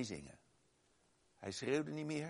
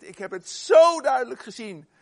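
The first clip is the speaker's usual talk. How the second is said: much louder and higher, bursting with excitement; excited retelling; 10 semitones higher, 20 dB louder than usual. Retold or imitated. Foster son telling the preacher what he saw, loud and excited. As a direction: shout it out like an amazed witness.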